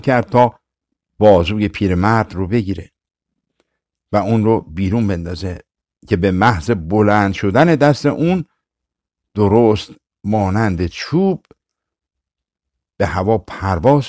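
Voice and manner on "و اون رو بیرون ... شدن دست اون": ordinary delivery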